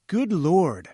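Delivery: startled cry. Acted. Narrator cries out at the pulsing beast, startled. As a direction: gasp it out at the sight. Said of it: "I cried".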